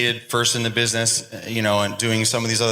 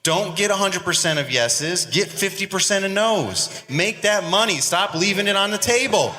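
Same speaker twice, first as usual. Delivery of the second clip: hyped up; 7 semitones higher than usual. Real, not acted. Hyping up the audience.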